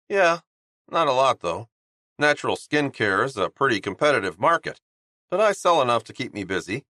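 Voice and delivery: chirpy guy pitching his skincare line; cheerful Honeybun. Acted. Honeybun speaking, narrator-voiced.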